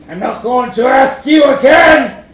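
loud, high, furious yell; monster shout. A narrator's reading. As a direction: roar the threat. Monster threatens Mac.

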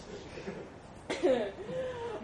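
big mocking elephant laugh; booming laugh.

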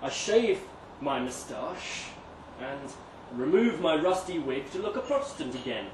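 plain reading voice

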